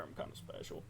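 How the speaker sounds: a low whispered joke at himself; whispered self-mocking aside. Gamer mocking his own map clicking, in a whisper. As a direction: breathe it out under your voice, self-deprecating.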